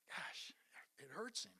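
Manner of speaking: whispered ache; barely voiced sorrow; hushed pain. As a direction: let the hurt drop to a whisper. Preacher feeling Jesus' sorrow.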